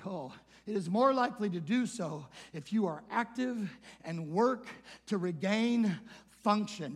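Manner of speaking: ordinary talk, nothing marked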